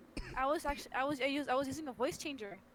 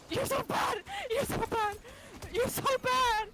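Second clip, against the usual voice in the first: excited shriek; 13 semitones higher, 6 dB louder, slower than usual. Real, not acted. Excited outburst, repeated.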